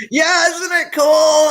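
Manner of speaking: high, loud and slow, beaming with pride; dad shouting happily about his gold car; proud excited dad. Retold or imitated. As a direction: show off the new car like a delighted father.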